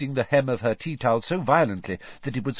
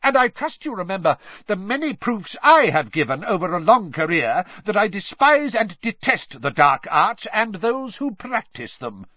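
higher, louder furious claim; angry shout; 10 semitones higher, 5 dB louder than usual. A narrator's reading. Crouch shouts at Diggory, defending his record. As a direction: shout your innocence with contempt. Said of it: "Mister Crouch shouted".

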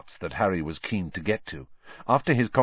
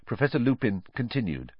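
ordinary delivery, nothing marked

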